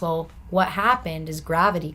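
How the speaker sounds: ordinary delivery, nothing marked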